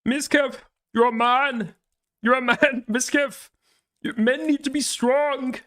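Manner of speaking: high, grandiose self-help preaching; hammed-up Jordan Peterson-style sermon; mock guru lecture. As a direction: lecture him like a pompous life coach.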